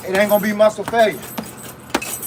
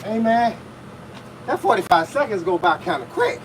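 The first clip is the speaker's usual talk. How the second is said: ordinary talk, nothing marked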